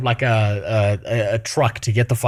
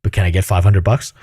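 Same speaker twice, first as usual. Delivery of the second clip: mocking tenant voice; 6 semitones lower, 5 dB louder than usual. Mocking the tenant's request again.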